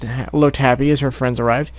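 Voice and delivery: usual speaking voice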